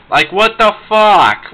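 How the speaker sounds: slow, drawn-out fed-up outburst; exasperated outburst. Real, not acted. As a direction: stretch each word in disbelief. Ranting about the 4Kids dub.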